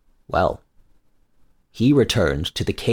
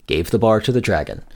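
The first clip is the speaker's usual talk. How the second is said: usual speaking voice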